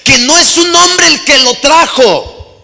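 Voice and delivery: loud, high preaching at full force; preacher driving the call home, loud and high; fiery preaching. Acted. Preacher urging the congregation, loud and high, at full force.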